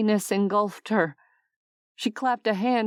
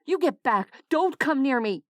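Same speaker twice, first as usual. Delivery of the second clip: angry; 5 semitones higher than usual. Acted. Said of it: "anger coming to her defense".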